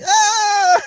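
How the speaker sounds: shrieked high and loud; mock high scream. Retelling the movie character's scream, high mock scream. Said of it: "He's like".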